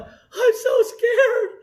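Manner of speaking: high-pitched, hammed-up tearful wail; mock scared whining. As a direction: whimper in mock panic, far above your own voice. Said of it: "Now you think you're going".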